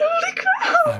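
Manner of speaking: high, slow, laughing holy crap; stunned laughing exclaim. Real, not acted. Streamer reacting to the zombie reveal, laughing in disbelief. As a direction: blurt it out half laughing in disbelief.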